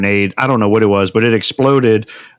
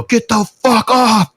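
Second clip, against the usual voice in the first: high, drawn-out mock yell; retold yell; 12 semitones higher, slower than usual. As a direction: imitate yelling an order, pitched way up. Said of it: "and I yell".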